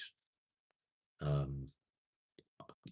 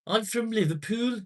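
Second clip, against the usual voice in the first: high, loud Liverpool caricature; mock Scouse; 8 dB louder than usual. Streamer imitating how Liverpudlians say it, mock Scouse. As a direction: put on an exaggerated high-pitched Scouse accent. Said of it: "It's the way they say it".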